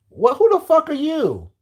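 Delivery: high, slow incredulous outrage; outraged disbelief. Real, not acted. Reacting to a preachy commenter, high and slow. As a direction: stretch it out, shocked.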